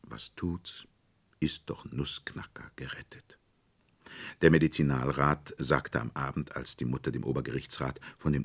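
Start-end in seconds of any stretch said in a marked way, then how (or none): 0.0-3.4 soft whispered inner thought; quiet thought. A narrator's reading. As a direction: hush it to yourself. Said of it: "denn sie dachte".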